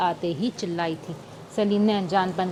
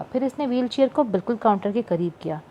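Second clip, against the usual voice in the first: ordinary delivery, nothing marked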